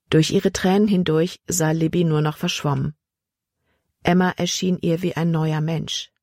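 ordinary delivery

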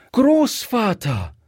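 high, drawn-out cry of alarm; dismayed little girl calling out; startled child. A narrator's reading. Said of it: "rief das Kind erschrocken".

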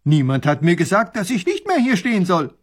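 loud, rising, indignant defence; offended soldier. A narrator's reading. Soldier defending himself to the professor, offended. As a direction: protest as if wronged. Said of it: "macht ein beleidigtes Gesicht".

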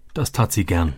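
ordinary delivery, nothing marked

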